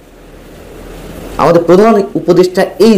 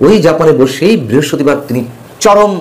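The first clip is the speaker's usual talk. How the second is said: ordinary delivery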